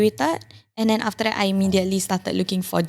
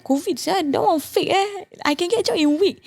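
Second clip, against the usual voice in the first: excited; 9 semitones higher than usual. Retold or imitated. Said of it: "Then I was like".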